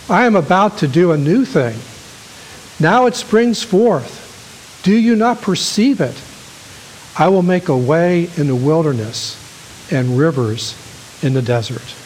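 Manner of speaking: preacher crying out the words of Isaiah; fervent scripture proclamation. Acted. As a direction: lift the prophecy up and out to the hall. Reads scripture to congregation.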